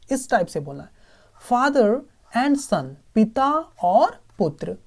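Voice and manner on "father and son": Father and son said very slowly, each word spelled out in sound; slow careful model reading. Shown as a demonstration. Teacher models the right f in Father, slowly and carefully. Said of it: "इसको हम ना father बोलना है".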